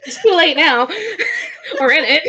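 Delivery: giggling resignation; laughing. Real, not acted. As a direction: say it while still laughing.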